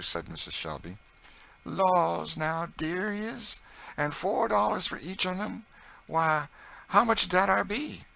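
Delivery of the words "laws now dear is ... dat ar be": very high, excited dialect voice; delighted Southern cook. A narrator's reading. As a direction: gush with joy in folksy Southern dialect. Narrator voices Chloe delighted.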